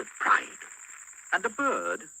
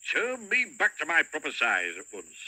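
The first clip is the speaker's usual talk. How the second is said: mild rage; 5 semitones higher, 6 dB louder than usual. Acted. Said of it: "spluttered Mister Uppity in a rage".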